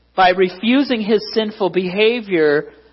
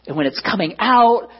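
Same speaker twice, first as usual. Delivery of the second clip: usual speaking voice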